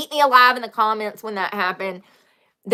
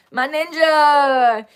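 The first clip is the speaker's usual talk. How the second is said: drawn-out, louder, higher playful call; imitating older brother; 5 semitones higher, 5 dB louder, much slower than usual. Sister echoing her brother's old nickname for her, drawn-out call. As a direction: stretch it out like a brother's greeting. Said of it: "My brother used to say that all the time".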